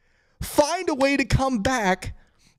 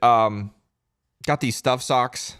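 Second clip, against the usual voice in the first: usual speaking voice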